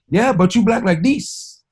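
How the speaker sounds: high put-on voice; mock store clerk. Clerk delivering the punchline in high mock voice. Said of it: "And he was like".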